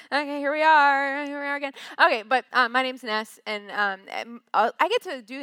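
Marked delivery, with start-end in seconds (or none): 0.1-1.3 louder mock-theatrical here we are; mock-grand resignation, louder; resigned announcement. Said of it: "And I'm like". 1.3-1.7 softer, deflated here we are again; softer resigned mutter; weary aside. Retold or imitated. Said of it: "And I'm like".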